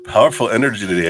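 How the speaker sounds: ordinary talk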